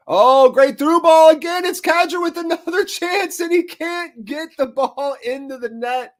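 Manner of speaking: real excitement as the shot goes in; excited. Real, not acted. Commentator reacting to a near goal, excited high call.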